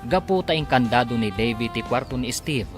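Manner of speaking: ordinary talk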